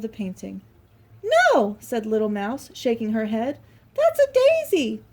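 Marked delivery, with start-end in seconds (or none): 1.2-1.8 tiny high squeak of a no; very high, loud mouse no; squeaky little mouse; Little Mouse's voice. Acted. 3.9-5.0 very high, slow squeaky mouse. Acted. Little Mouse voiced in story.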